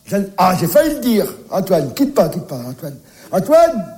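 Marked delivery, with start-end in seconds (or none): 3.3-3.9 calling out. Acted. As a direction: call the name out across the flat. Calling out for the child.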